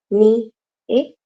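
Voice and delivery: "Ni, e" with a gap between; separated syllables. Shown as a demonstration. Teacher showing how to fake ñ, "Ni, e" split in two. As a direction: say "Ni" and "e" apart. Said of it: "separado, así".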